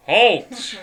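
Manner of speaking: loud commanding shout; booming halt. Acted. GM voices hidden wizard.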